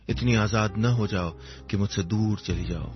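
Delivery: ordinary delivery, nothing marked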